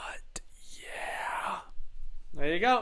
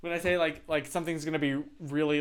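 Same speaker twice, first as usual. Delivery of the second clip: ordinary talk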